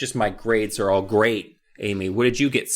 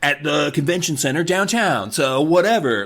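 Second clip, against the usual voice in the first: smug brag; 4 semitones higher than usual. Retold or imitated. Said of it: "she's like".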